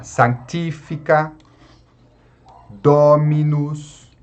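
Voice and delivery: sanctifica stressed on "ti", dominus on a drawn-out "do"; antepenultimate stress. Shown as a demonstration. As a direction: model sanctifica and dominus, antepenultimate syllables strong. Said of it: "acentuaremos todas as palavras proparoxítonas". (sanctifica e dominus com a antepenúltima sílaba forte)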